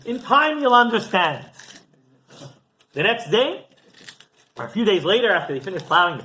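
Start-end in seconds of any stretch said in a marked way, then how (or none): 0.0-1.5 calm reassurance. Retold or imitated. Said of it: "the farmer's like".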